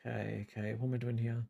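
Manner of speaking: low, very soft self-talk; muttering to self. Real, not acted. Streamer absorbed in drawing talks to self, muttering. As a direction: think aloud under your breath.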